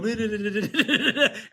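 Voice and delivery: loud, high rapid-fire babbling taunt; high-pitched rattling tease at a dog; teasing babble noise. Retold or imitated. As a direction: pester a dog with silly rattled-off nonsense sounds. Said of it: "go up to a dog and go".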